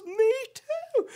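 very high mock squeal; amazed excitement. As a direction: imitate a thrilled man, voice leaping right up. Homeless man's retold excitement.

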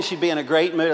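ordinary talk

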